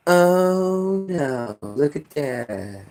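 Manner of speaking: slow, soft mock dismay; a limp bystander's sigh; mock helpless. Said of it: "what do we do".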